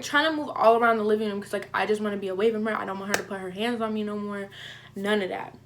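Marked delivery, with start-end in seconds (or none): none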